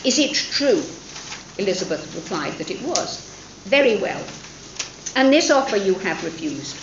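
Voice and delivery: lightly voiced stern father announcing a choice; reading a novel scene aloud. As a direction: read the scene with the father's dry authority. Reads the father-daughter scene.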